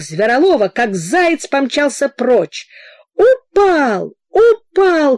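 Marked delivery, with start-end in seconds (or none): 3.1-5.2 high, loud excited yells; crowd shouting. A narrator's reading. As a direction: call out the news from all sides. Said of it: "кричали со всех сторон".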